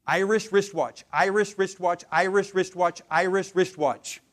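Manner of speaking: Irish wristwatch said clearly, each sound crisp; tongue twister. Shown as a demonstration. Coach demoing a tongue twister, crisp and clear. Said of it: "my magic phrase".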